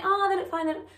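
mock reassurance. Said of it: "And don't tell me".

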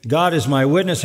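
normal talking voice

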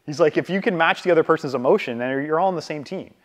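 usual speaking voice

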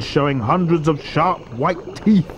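usual speaking voice

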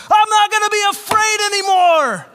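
high, bellowed declaration; all-out yell at top volume; full-out shout. Retold or imitated. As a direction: yell it like you mean it, to get it over with. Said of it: "say it like you mean it".

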